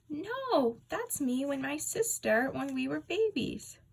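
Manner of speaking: a hint of a cheerful older lady; lightly voiced secretary.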